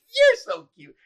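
high, loud cutesy squeal; playful squeal. Retold or imitated. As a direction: tease right back. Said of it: "she goes".

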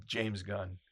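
normal talking voice

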